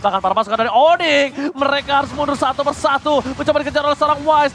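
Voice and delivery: hyped, high play-by-play call; fighting game announcer. Acted. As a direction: call the teamfight at full hype. Live play-by-play at fight climax. (komentator heboh bernada tinggi)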